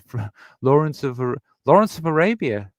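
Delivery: real flustered retry; tongue-tied stumbling. Real, not acted. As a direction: stumble on the name, then force it out. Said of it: "[name], spit it out".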